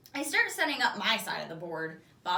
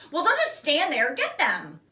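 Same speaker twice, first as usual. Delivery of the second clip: eager urging; 5 semitones higher, 8 dB louder than usual. Acted. Bob urging excitedly.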